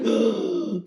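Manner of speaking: sharp intake of breath; mock startled gasp; shocked gasp. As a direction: gasp as if finding an intruder. Everyone sees a body on bed.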